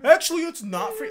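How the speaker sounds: high, loud smug correction; mock the pedantic correction; know-it-all voice. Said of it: "And then people go".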